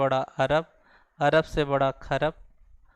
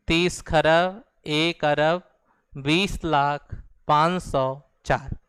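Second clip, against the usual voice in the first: slow model reading; slower than usual. Shown as a demonstration. Teacher reading the full number slowly as a model for students. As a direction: read the whole number slowly, unit by unit. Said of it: "ऐसे इसको पढ़ना है".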